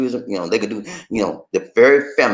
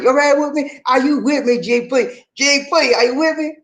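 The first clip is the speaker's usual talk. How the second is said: hype call; 11 semitones higher than usual. Real, not acted. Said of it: "hyping up the class".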